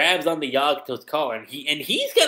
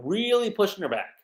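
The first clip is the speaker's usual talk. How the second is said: normal talking voice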